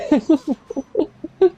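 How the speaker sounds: high-pitched burst of laughter. Real, not acted. Laughing after asking the group about getting disappointed, high giggling laugh. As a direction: laugh in a high, rising run.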